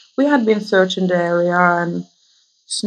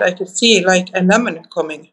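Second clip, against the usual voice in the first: usual speaking voice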